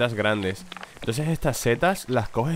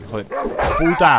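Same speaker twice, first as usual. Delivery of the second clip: higher, louder, dragged out swear; slow furious curse; 6 semitones higher, 6 dB louder, much slower than usual. Real, not acted. Gamer who just died, slow furious curse. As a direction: spit it out slowly in fury. Reacting to his death in game.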